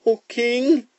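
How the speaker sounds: very high little rabbit voice; timid rabbit. Acted. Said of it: "said the rabbit".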